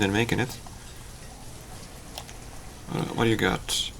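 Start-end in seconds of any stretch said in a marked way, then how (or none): none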